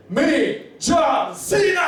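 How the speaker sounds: high, drawn-out ring-announcer yell; hammed-up triumphant shout; wrestler's announcement. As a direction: shout your own name like a wrestling entrance. Acted wrestler's dramatic self-announcement.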